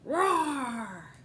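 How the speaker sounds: loud growling dragon roar. Acted. Dragon roaring at the knights, loud growl. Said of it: "there was a terrible roar".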